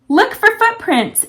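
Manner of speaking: high, slow yell across the yard. Acted. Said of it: "she yelled to Gia".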